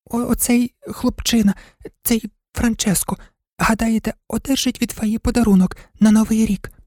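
high-pitched, hesitant little dog voice; timid toy dog. Acted. Toy dog airing his thought to the shop-window toys, timid high voice. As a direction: speak up shyly, high and haltingly.

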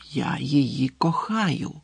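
slow breathless whisper; barely audible whisper. A narrator's reading. Boy repeating his confession to his friend, a slow whisper. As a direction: whisper it, almost to yourself. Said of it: "ледь чутно повторив Рон".

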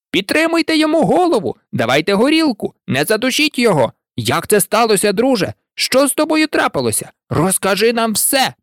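high, urgent crowd voices; clamouring shouts. A narrator's reading. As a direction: fuss over a hurt friend all at once. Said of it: "почали кричати всі разом". (схвильовані вигуки натовпу)

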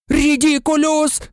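high, straining roar of a spell; high-pitched yell; Ron's roar. A narrator's reading. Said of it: "заревів Рон".

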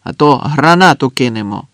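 loud threat in acted voice; shouted threat. A narrator's reading. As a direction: call out the warning forcefully. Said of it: "крикнув хтось".

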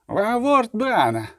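curt, high-pitched bark; barked order. A narrator's reading. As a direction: snap it as a command. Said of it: "гавкнув". (гавкнути наказ)

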